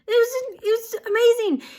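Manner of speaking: very high, gushing wonder; overwhelmed amazement. Real, not acted. Telling friends about a man walking, overwhelmed amazement. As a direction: let amazement lift the voice way up. Reliving witnessing a man walk.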